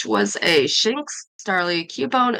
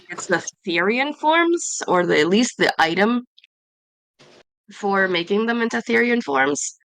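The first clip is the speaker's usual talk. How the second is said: ordinary talk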